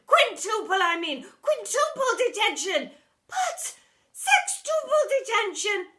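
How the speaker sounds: high, loud, frantic shouting; escalating rant. Acted. Deputy head ranting punishments.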